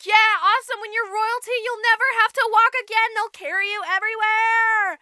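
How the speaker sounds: high, loud, rushing celebration; crowing stepmother. Retold or imitated. Stepmom promises daughter royal life, crowing loudly. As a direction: crow in mock triumph.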